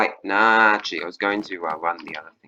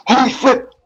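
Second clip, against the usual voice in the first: much higher, louder excited yelps; astonished excitement; 9 dB louder than usual. Real, not acted. To the mutant panda, high excited yelling. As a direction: shout like you found treasure.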